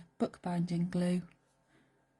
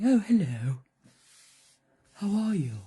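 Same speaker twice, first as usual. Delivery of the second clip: loud, well-spoken talking donkey; loud, plummy donkey greeting; posh donkey voice; 2 semitones higher, 7 dB louder than usual. Acted. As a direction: give the donkey a posh hello. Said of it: "that's how they talk".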